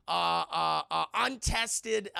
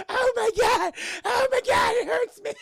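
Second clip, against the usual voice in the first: high, loud hammed-up wail; exaggerated high-pitched cry of pain; mock pained screaming; 8 dB louder than usual. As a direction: shriek as if being electrocuted, half laughing. Cartman acted being shocked, in pain.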